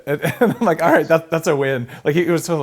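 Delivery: high, loud, amused delivery; amused retelling. Retold or imitated. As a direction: retell it with a laugh in the voice. Laughing as he retells his reaction.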